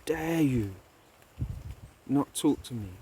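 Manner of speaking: the king roars at being ignored; angry king. Acted. Scene: a proud king snaps at the wise man, furious. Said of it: "he gets so angry with him".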